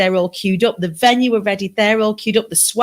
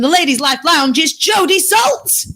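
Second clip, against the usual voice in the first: announcer shout; 7 semitones higher than usual. Real, not acted. Host hyping the guest's entrance with a big announcer shout. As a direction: hype her name like a ring announcer.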